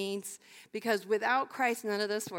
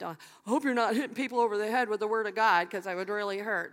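teasing; faster than usual. Retold or imitated. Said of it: "They were teasing 'cause they said".

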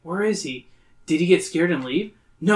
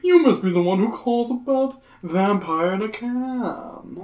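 creepy host voice; 7 semitones higher than usual. Acted. Voices Herman Carmine.

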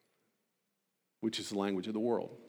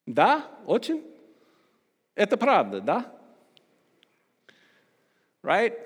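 higher, louder show-off Russian accent; 10 semitones higher, 7 dB louder than usual. Shown as a demonstration. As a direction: rattle off Russian like a native, hammed for the crowd. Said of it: "Talk like Russian".

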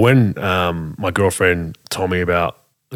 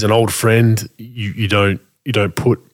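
ordinary talk

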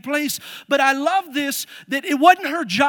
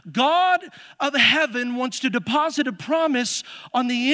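louder, rising preacher's call; full-force preaching; 4 dB louder than usual. Acted. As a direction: drive it home to the congregation.

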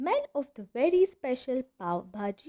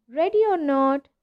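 calling out; 4 semitones higher, 7 dB louder than usual. A narrator's reading. Seeker calling out.

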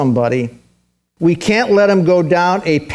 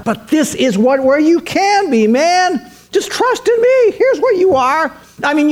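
rising higher and higher, urging Zacchaeus onward; Jesus coaxing; 9 semitones higher than usual. Retold or imitated. Jesus urging Zacchaeus to trust him, climbing higher voice. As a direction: lift the voice to coax him toward a better life. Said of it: "he says".

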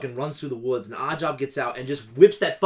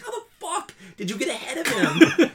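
high, laughing, half-crying incredulity; laughing disbelief; 11 semitones higher than usual. Real, not acted. Ranting to friends about the tank, laughing in disbelief. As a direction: laugh through the outrage. Mocking absurd Bond chase.

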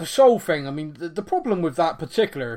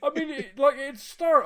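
ordinary delivery

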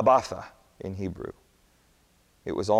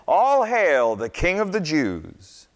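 slow, loud mock hail; 8 semitones higher, 5 dB louder, slower than usual. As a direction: hail him in jeering ceremony. Soldiers mocking Jesus.

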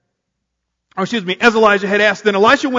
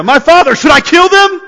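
louder, higher urgent shout; 8 semitones higher, 7 dB louder than usual. A narrator's reading. King of Israel shouting to Elisha, loud and urgent. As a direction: call out to the prophet, desperate to act. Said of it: "he shouted to Elisha".

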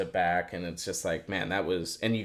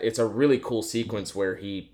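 ordinary delivery

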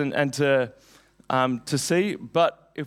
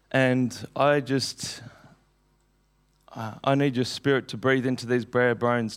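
prayer; 3 semitones lower than usual. Acted. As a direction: pray it low and earnest. Opening prayer before the sermon.

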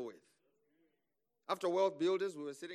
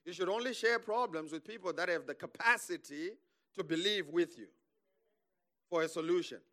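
normal talking voice

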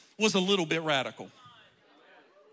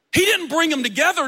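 usual speaking voice

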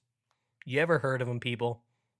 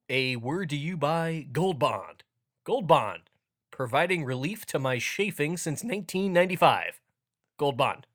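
higher, louder hammed-up commercial voice; hammed-up sponsor announcement; mock ad read; 4 semitones higher, 4 dB louder than usual. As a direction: sell it like a cheesy radio spot.

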